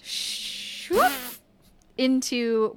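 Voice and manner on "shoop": high breathy whoosh for shrinking; a quick airy shoop as someone shrinks; shrinking sound effect. Acted. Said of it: "When you went whoop".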